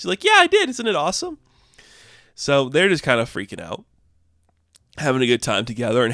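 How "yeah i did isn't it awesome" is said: much higher, bursting with pride; a giddy, high pitched show-off; giddy excited squeal. Retold or imitated. Engineer proudly shows off tech.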